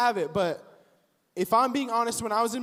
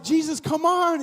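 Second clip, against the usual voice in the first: impatient urging; 7 semitones higher, 4 dB louder than usual. Retold or imitated. Impatient Jairus urging Jesus.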